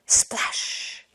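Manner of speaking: breathy whispered splash effect. Acted. Cubs jumping in the river, whispered splash. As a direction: voice the splash softly.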